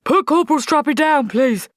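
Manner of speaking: loud barking drill sergeant; gruff sergeant. Acted. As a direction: bark it like an old army sergeant.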